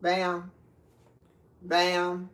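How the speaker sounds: ordinary delivery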